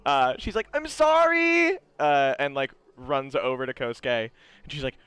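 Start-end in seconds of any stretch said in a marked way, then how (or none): 0.7-1.8 high, loud, drawn-out call; acted wailing apology; shouted apology. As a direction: yell it while running away, hammed-up. Character calls out while running off.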